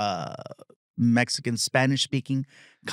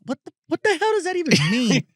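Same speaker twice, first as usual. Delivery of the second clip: high, disbelieving "what the hell"; incredulous outburst; 4 dB louder than usual. Retold or imitated. Comedian voicing his own reaction to fans, high incredulous. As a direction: snap back in mock disbelief, pitched up. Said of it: "part of me wants to go".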